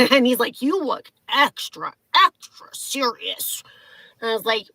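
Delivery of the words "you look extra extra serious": drawn-out mock-grave imitation. ID checker teasing woman in line, slow and mocking. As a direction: stretch each word, fading into a whisper. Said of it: "Like, mocking my face".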